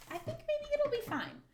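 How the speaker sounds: very high, loud silly squeak; squeaky silly voice. Pretend. Crafter reassuring herself in a silly squeak. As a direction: squeak it out like a cartoon.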